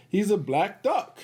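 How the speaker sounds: plain reading voice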